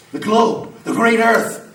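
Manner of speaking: loud, boastful tirade of power; carried away. Acted. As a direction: boom it out like fire challenging the world. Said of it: "I'm getting carried away".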